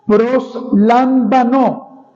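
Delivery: "proslambanó" said very slowly, syllable by syllable, accent on the final O; spelled-out Greek word. Shown as a demonstration. Preacher teaching the congregation the Greek verb, very slow and careful. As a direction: draw out "proslambanó" slowly with stress on the last O. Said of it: "Me repito, verbo".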